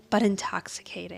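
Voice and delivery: soft breathy aside; whispered. Retold or imitated. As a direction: breathe it out dreamily. Mason's dreamy report.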